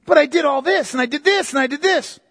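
mock whining, pitched high; hammed-up pleading; whiny protest. The rejected suitor whining at the girl, mock pleading. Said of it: "And she's like".

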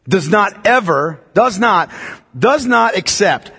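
"does not ever" said slowly and deliberately, each word weighted; stress on does not ever. Shown as a demonstration. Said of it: "does not ever is what it says in Greek".

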